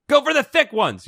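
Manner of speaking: high, urgent order; urgent command. Acted. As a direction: call it out to Liz. Character shouts order.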